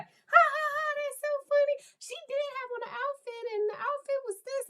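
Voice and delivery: put-on very high, fast girl voice; tiny, racing, giggly chatter; giggly daughter. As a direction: squeal it like a tickled teen gossiping. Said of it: "she was like".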